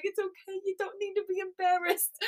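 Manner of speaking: put-on high, soft baby-talk comfort; tender, high little coo; soothing a baby. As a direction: imitate gently reassuring a crying infant. Retold soothing of crying baby.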